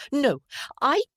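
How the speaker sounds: high, loud protest cut short; petulant denial. A narrator's reading. Sister denies it until silenced, petulant. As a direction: start to argue back indignantly.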